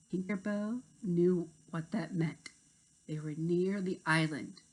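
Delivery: plain reading voice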